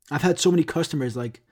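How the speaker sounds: ordinary talk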